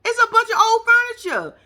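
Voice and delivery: high, loud scornful put-down; mock disgusted retort. Host mocking the venue's old furniture, loud scornful retort. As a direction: sneer at the room. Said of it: "I said".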